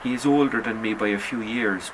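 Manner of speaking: ordinary delivery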